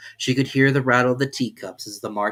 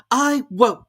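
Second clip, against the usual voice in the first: defiant Alice; 8 dB louder than usual. A narrator's reading. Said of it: "said Alice".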